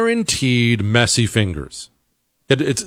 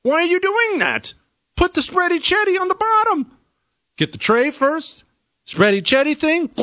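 exasperated high pleading. Acted. Host exasperated, shouting advice.

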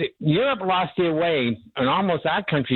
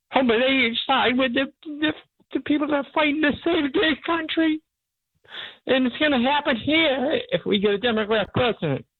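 choked up; 10 semitones higher than usual. Real, not acted. Caller to host about Ireland and England, voice breaking, choked up. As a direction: voice breaking high with real emotion. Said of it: "I got a choke up thinking about".

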